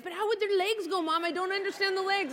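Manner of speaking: put-on high-pitched baffled little girl; fretting child. Daughter to mom about cats, hung up on the legs. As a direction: puzzle over it like a fixated kid. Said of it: "she got all caught up in the legs".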